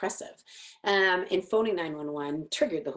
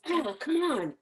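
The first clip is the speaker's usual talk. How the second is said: higher, fed-up outburst; exasperated dad; 9 semitones higher, slower than usual. Retold or imitated. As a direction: imitate a dad snapping over the coffee. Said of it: "he says".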